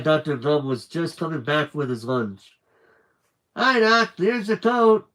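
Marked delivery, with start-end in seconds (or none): 3.5-5.1 gasping. Acted. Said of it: "Bugs gasped".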